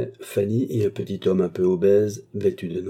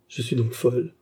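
understated dread, rising in pitch and volume; accent of terror; 5 semitones higher, 3 dB louder than usual. A narrator's reading. Patient doubting her own sanity, mildly terrified. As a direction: let horror creep into the realisation. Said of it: "avec un indicible accent de terreur".

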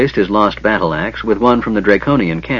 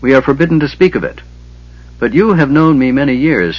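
proud; 4 semitones higher than usual. A narrator's reading. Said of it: "lifted his head proudly".

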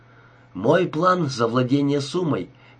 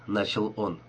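ordinary delivery

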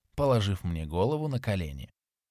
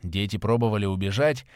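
ordinary delivery

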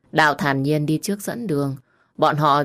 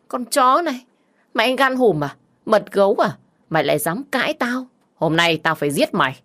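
furious yelling; 6 semitones higher than usual. Acted. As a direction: scream threats in a drunk rage. Husband explodes, threatens to kill.

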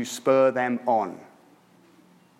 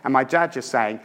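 ordinary talk

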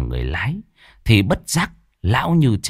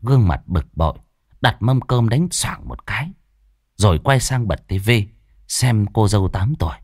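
usual speaking voice

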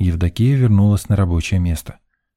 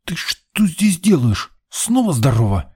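slow, high, strained hiss of a telling-off; hissed scolding; 9 semitones higher, slower than usual. A narrator's reading. Man grabs the boy by the shoulder, hissing. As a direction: hiss it slowly at a naughty kid. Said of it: "зашипел я".

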